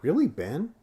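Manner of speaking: imitated, high-pitched questioning reaction; a retold "oh really?" lifted high; mock surprise. Friend retelling how he reacted to him, mock surprised.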